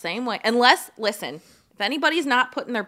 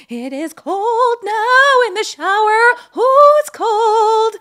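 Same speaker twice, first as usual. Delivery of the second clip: higher, louder, slow shivering cries of cold; cold shock cries; 13 semitones higher, 11 dB louder, slower than usual. Retold or imitated. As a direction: draw it out like icy water just hit you. Retelling shock of cold shower.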